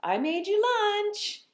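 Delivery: put-on high, slow little-girl voice; dad voicing his daughter serving pretend lunch, high and slow; imitating daughter. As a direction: lightly play the small daughter offering lunch. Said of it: "my daughter says".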